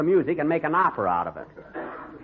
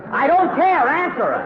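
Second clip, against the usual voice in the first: exasperated yell; 11 semitones higher, 5 dB louder than usual. Acted. Boss snaps at servant.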